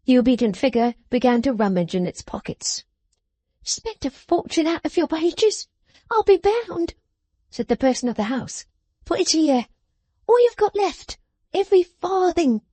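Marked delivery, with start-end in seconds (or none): 3.6-6.9 high, sharp scolding voice; scolding little woman. A narrator's reading. Jenny scolding. 9.0-12.6 high, sharp scolding voice; scolding little woman. A narrator's reading. Jenny demanding.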